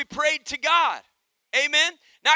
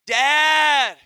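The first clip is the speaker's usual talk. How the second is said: calling out; 3 semitones higher, 8 dB louder than usual. Acted. Boy calling down the hallway.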